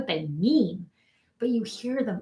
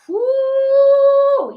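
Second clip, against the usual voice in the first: long, high, loud whoop; elated whoop; 14 semitones higher, 9 dB louder than usual. Real, not acted. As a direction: cheer it out after the win. Cheers after the power affirmation.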